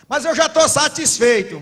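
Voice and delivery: mais alto, agudo e lento, imitando o cônjuge; dá voz ao marido que se acha satisfeito; objeção imitada. Retold or imitated. (marido se defendendo, mais alto, agudo e lento)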